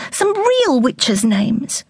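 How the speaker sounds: put-on a young girl begs her mother, in a high child voice; little girl. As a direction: ask like a curious young girl. Daughter insists.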